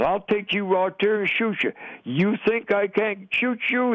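worked-up retelling of a raging threat; retold angry screaming. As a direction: imitate a mad interrogator's threat without shouting. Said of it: "he was screaming this".

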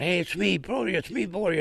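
calling out high across the dark; hollering. Retold or imitated. Soldier calling out to his own guns at night, hollering. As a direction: holler your name so your own side knows you. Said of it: "started hollering".

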